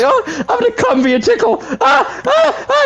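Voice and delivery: quick, very high squealed moans; hammed-up falsetto gasping through laughter; mock moaning. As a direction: imitate ticklish, overcome squealing. Acting out moans in crude story.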